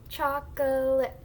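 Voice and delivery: Chocolate slow, each syllable stretched. Shown as a demonstration. Teacher lets the class try blending, syllable by syllable. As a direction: draw Chocolate out in three slow beats. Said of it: "we are blending into three syllables".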